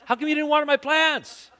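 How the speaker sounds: hammed-up outrage over the plants; exasperated outburst. Husband mock-yelling at wife about unwatered plants, high and loud. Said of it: "could not walk in the house and go".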